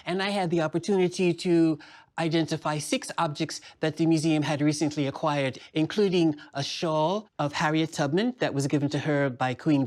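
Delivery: a puppet docent proudly describing her tour; Miss Lily puppet voice. Acted. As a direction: speak as a gracious museum guide puppet. Puppet docent introduces herself.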